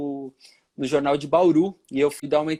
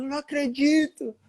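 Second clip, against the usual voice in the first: mom's disbelief; 9 semitones higher than usual. Retold or imitated. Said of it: "Aí ela".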